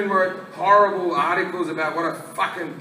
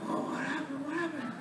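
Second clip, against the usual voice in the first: very soft, higher confused murmur; hushed, bewildered little voice; groggy waking; 5 semitones higher, 16 dB quieter, faster than usual. Retold or imitated. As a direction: act out coming round from being drugged. Waking up drugged, acted out.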